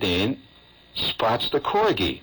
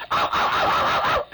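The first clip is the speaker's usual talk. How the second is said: little dog yelps, unvoiced; breathy dog yapping; yapping corgi. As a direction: yip like a tiny corgi. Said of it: "he goes".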